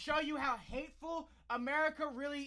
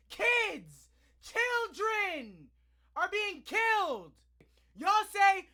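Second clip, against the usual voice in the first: loud, higher, slowed outrage; furious shout; 6 semitones higher, 8 dB louder, much slower than usual. Real, not acted. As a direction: yell it at the whole room. Angry rant about shootings.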